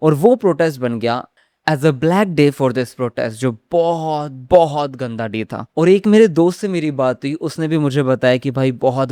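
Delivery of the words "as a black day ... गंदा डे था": slow grim emphasis. Real, not acted. Grim verdict on the protest day.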